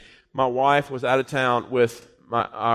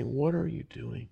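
gentle question; 8 dB quieter than usual. Retold or imitated. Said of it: "God said to me".

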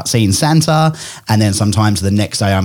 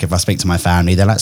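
normal talking voice

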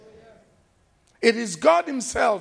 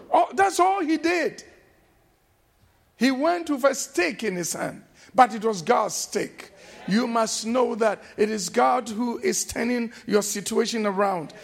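preaching at full force; 4 dB louder than usual. Acted. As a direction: preach it out to the congregation. Preacher climaxes, loud and high.

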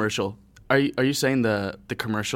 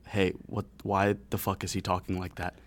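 low, softer puzzled question; baffled aside; 3 semitones lower, 7 dB quieter than usual. Retold or imitated. Friends asking about Kevin's odd voice, baffled and low. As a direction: ask it low, as if behind his back.

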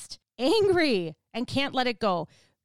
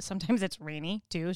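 ordinary talk

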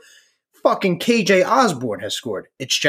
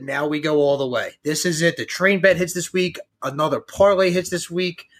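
ordinary talk, nothing marked

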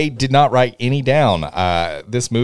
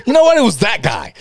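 much higher, loud mock shout; imitating her outburst; 12 semitones higher, 7 dB louder than usual. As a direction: play up her sudden realization. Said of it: "She's like".

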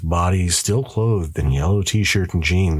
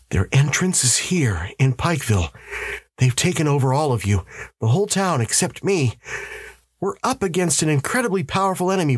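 higher, insistent husband's voice; urgent husband; 9 semitones higher than usual. A narrator's reading. Husband warning his wife of a powerful enemy, urgent. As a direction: press the warning on a disbelieving wife.

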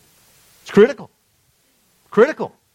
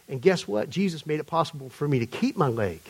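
softer, lower imitated Atlanta testimony; attempted Atlanta accent; 5 semitones lower, 11 dB quieter than usual. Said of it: "he's telling the story in his accent".

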